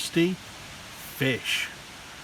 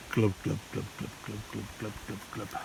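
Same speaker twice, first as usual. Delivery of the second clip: low bubbling glubs; 7 semitones lower, 11 dB quieter, much faster than usual. Acted. Fish sinking bubble sounds.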